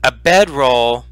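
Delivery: slow, measured read-aloud description; game master's scene setting. A narrator's reading. Game master describing the ruined chamber to players, slow and measured. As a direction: paint the room for the players slowly.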